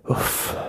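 breathy, voiceless groan of relief. Acted. Mira after the fall, groaning out her breath. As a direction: let out a soft, airy "oof". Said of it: "stöhnte Mira laut auf".

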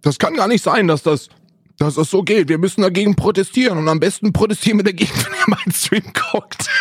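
indignant streamer pleading for viewers; Zinni impression. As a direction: ham up a streamer's protest speech. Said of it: "Zinni A K A".

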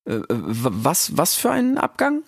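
put-on high, halting young voice; timid Rix. Rix confused. (schüchterner Rix, hoch und stockend)

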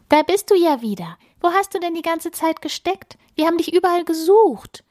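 excited calling. A narrator's reading. Family of snowflakes greets the lost flake, excited and fast. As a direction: tumble out the welcome in a rush. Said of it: "rufen die Flocken aufgeregt durcheinander".

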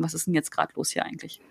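leise, nach innen gefragt; quiet. Retold or imitated. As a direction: sich selbst ganz sanft fragen. Quoted inner question to self. (leise Frage an sich selbst)

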